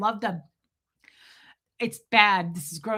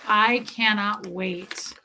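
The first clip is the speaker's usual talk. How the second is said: slow, drawn-out retold reply; deliberately slow, dwelling on the anticipation; savoured anticipation; much slower than usual. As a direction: stretch every word with relish. Retold answer to the cashier.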